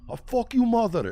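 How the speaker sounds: high, loud mock Turkish yell; mock Turkish troll. Quoting Turkish trolls' replies, high loud mock accent. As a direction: squeal the insult like an angry troll. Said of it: "they'd be like".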